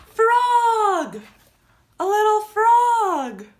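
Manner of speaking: loud, high, drawn-out animal reveal; excited reveal. Pretend. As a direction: announce it slowly with big delight.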